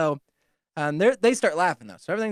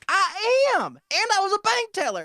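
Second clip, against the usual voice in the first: put-on very high, piping old-woman voice; insistent old lady; 3 dB louder than usual. Old lady announcing she was a bank teller, insistent piping voice. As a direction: pipe up proudly in a mock old-lady voice. Acts out old lady's proud answer.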